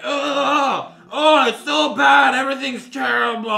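high, loud anguished cry; loud wail. Pretend. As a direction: wail it out in despair. Mock despair.

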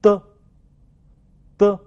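"的" as an isolated French d/t drill sound; "的" tongue-tip sound, given twice separately; model consonant sound. Shown as a demonstration. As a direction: say "的" short, then pause for repetition. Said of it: "请大家跟我读".

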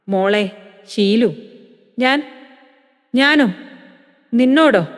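put-on a mild break in the old man's voice; faltering voice. Father-in-law realizing what he did to Sheelu, faltering. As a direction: stumble over the words in shock, rising at the end. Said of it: "അപ്പച്ചന്റെ ശബ്ദം ഇടറി".

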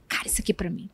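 hushed, whispered realisation to oneself; whispered aside. Acted. Reader to herself on finding a line that fits, whispered. Acts out reader's private reaction.

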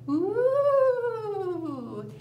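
long rising ghostly ooh; a ghost's moaning ooh; spooky ooh. Shown as a demonstration. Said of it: "sound real scary".

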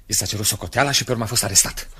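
ordinary talk

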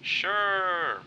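high, loud, drawn-out "sure"; sarcastic agreement. Acted. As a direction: stretch it out in mock willingness. Mock agreement to hand over gun.

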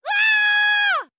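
very high, loud shriek of pain; piercing cry of shock; sharp scream. Acted. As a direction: scream out as if struck. Character hit during chaos.

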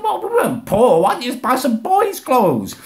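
high, exasperated comeback; indignant. Retold or imitated. Arguing back at dad about the clothes, indignant.

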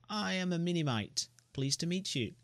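high, bright little creature greeting; cheerful Minimite. Acted. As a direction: introduce yourself with a little bow. Voices the Minimite.

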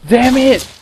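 high, loud burst of frustration; frustrated shout. Real, not acted. Gamer yelling at his failed run, a frustrated shout. Failing the level in a game.

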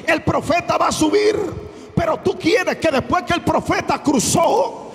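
loud, high-pitched preacher's cry; preaching at full force. Acted. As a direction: preach it with full fire to the congregation. Pastor predicando a toda voz. (predicación a todo pulmón)

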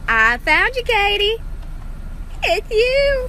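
high, loud thrilled cry; excited shout. Real, not acted. Vlogger discovering a drawing of herself, shouting excitedly. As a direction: greet it like a long-lost friend.

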